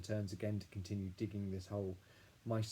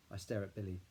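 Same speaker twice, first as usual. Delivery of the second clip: plain reading voice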